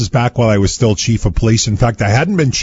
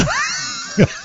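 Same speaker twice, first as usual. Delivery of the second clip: burst of laughter; 5 dB quieter than usual. Real, not acted. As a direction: break into a big laugh. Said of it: "I looked at him".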